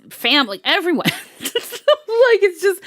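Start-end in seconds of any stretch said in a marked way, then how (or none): 1.1-2.0 light laugh. Real, not acted. Laughing at her own point.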